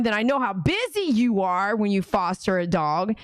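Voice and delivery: usual speaking voice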